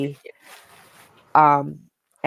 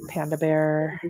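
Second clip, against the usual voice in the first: ordinary talk, nothing marked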